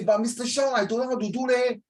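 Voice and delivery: high-pitched student protest. Acted.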